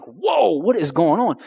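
a high, startled "Whoa" jumping up; mock-startled cry. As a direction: retell being caught off guard, high. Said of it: "I was like".

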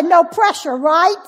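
joking aside. Retold or imitated. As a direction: toss it off loud as a nervous joke. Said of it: "He said".

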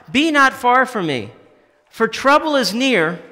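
rising lament read from the pulpit; impassioned scripture reading. Acted. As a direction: read it as David's plea to God. Reading psalm aloud with feeling.

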